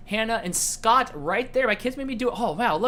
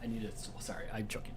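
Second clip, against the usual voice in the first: ordinary talk, nothing marked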